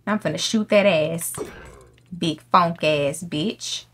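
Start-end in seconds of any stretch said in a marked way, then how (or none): none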